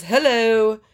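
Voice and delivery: lightly put-on pretentious greeting; mock grand hello, a touch affected. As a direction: imitate a self-important customer making an entrance. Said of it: "here we go with the pretension".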